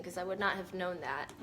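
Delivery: usual speaking voice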